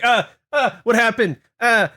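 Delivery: high, loud, panicked yelps; panicked cry. Acted. Imagined panic on going blind.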